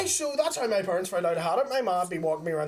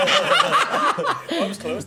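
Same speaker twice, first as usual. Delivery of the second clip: laughing; 9 semitones higher, 7 dB louder, much slower than usual. Real, not acted. Laughing reply.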